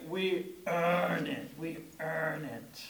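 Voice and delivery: put-on slow New England accent, EARN stretched out; drawn-out old New England tagline delivery; weighty, unhurried New England accent; John Houseman. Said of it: "He had that old, like, even New England, uh, accent there".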